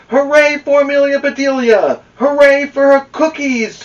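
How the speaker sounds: put-on high, loud jubilant shout of a group of boys; shouted cheer. A narrator's reading. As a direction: cheer it out loudly at a high, sustained pitch. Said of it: "shouted the boys".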